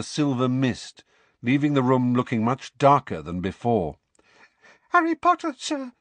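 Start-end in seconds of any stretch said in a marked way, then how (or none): 4.9-5.9 very high, squeaky elf voice; squeaky house elf. Acted. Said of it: "squeaked the elf".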